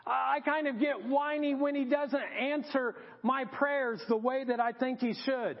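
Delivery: usual speaking voice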